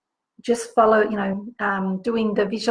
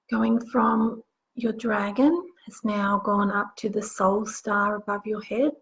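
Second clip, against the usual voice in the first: guided meditation; 5 dB quieter than usual. Acted. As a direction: guide them gently into the visualization. Leads a guided visualization.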